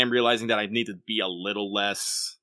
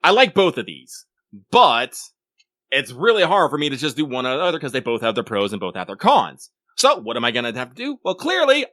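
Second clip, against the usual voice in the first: higher, louder, thinking out loud emphatically; animated self-quote; 5 semitones higher, 7 dB louder than usual. Retold or imitated. Player retelling their weapon dilemma, emphatic and animated. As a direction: act out your own earnest deliberation, building to a verdict. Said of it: "me goes".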